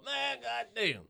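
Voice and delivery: high, loud, drawn-out mock astonishment; mock awe, pitched high and slow; hammed-up amazement. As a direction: stretch it out like an overwhelmed fan. Acts out an overwhelmed fan.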